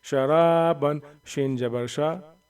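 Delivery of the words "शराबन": "शराबन" with रा held long. Shown as a demonstration. Teacher demonstrating the rule just given, long रा in "शराबन". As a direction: stretch only the रा in "शराबन". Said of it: "रा को तो खींचेंगे".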